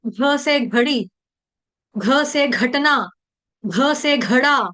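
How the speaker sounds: slow घ thrown out in घड़ी, घटना, घड़ा; drawn-out, breath-pushed घ in घड़ी; forced aspirate घ. Shown as a demonstration. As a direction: push a puff of air through each घ. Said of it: "फोर्स करके अक्षर बाहर को फेंकना है".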